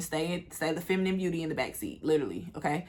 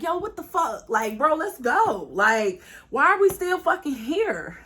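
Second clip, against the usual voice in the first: exasperated; 7 semitones higher, slower than usual. Retold or imitated. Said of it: "Me and Dro like".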